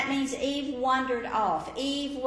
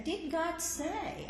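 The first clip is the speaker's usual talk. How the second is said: slow, higher, sly serpent question; 8 semitones higher, 4 dB quieter, slower than usual. Acted. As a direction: plant the doubt, slowly. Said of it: "he starts off by quoting".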